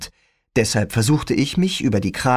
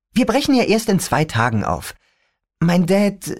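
put-on teen girl voice; 8 semitones higher than usual. Rachel's lines, higher voice.

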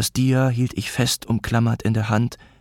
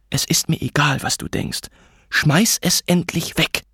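higher, impatient angry demand; mother's contained fury; suppressed arguing; 7 semitones higher than usual. A narrator's reading. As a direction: dismiss him, then demand hard. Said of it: "energisch, jedoch mit unterdrückten Stimmen".

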